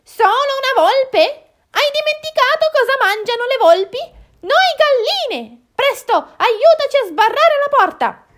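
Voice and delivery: very high, loud squawking; shrill panic in the henhouse; alarmed, scolding hens. Acted. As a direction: screech a scolding warning. Alarmed hens scold and bar door.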